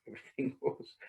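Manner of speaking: breathy laugh through "Everything goes"; laughing aside. Real, not acted. Teacher laughs about modern manners, whispering it through a laugh. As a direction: chuckle the words half under breath.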